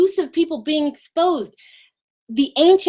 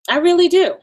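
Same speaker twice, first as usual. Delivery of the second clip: ordinary delivery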